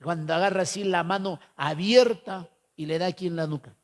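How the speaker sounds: ordinary talk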